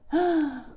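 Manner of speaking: quick high intake of breath; mock gasp. Reader to children before Crocodile appears, mock gasp. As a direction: gasp as if startled.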